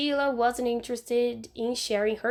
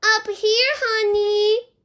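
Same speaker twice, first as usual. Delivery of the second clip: high, loud little boy playing a husband; a small child putting on a grown-up husband's call; best husband voice; 9 semitones higher, 7 dB louder, slower than usual. Fudge in the tree calling back to Sheila as her husband. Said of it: "in his best husband voice".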